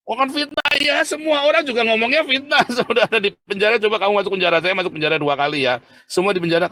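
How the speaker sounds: loud, high-pitched sermon outburst; impassioned preaching. Real, not acted. Berkhotbah berapi-api. (khotbah berapi-api dengan suara tinggi dan keras)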